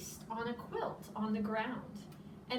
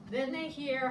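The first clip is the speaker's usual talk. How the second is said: ordinary delivery, nothing marked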